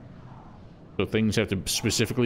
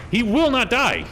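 loud higher yell, a notch below the first; repeated yell; 12 semitones higher, 5 dB louder than usual. Real, not acted. Gamer yelling again at the enemy who won't die, loud shout. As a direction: shout it again, hammering the point. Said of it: "Otis doesn't like it when I yell".

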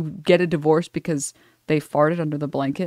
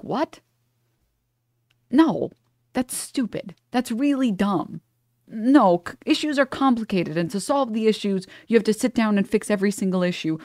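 higher-pitched, acted disbelief; mock baffled, dismissing a silly claim; incredulous rebuttal; 5 semitones higher than usual. Acted rebuttal to a bad argument.

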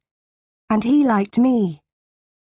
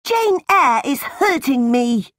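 high-pitched tattling wail; shouted complaint; 5 semitones higher than usual. A narrator's reading. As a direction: cry out to mother for rescue. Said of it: "John shouted".

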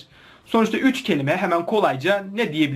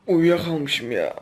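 sleepy; 3 semitones lower, 6 dB quieter than usual. Real, not acted. Just woke up, groggy.

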